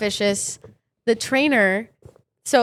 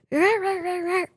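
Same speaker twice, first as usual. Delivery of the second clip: a light mock bark, higher; playful yappy dog noises; imitating dog growl; 8 semitones higher, 3 dB quieter than usual. Acting out the little dog lunging at the trainer, mock barking. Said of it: "then he's like".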